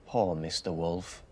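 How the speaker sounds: ordinary delivery, nothing marked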